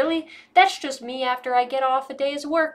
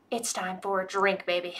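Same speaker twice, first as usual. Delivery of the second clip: low sultry aside; 4 semitones lower, 6 dB quieter than usual. Pretend. Announcing drink time to viewers.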